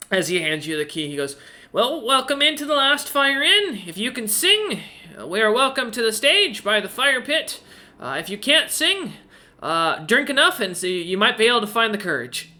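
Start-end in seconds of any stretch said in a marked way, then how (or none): 1.7-12.5 high, eager little owl voice; chirpy owl innkeeper. Acted. Owl innkeeper welcoming Roloman, high and eager. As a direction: play the helpful owl host, pitched up.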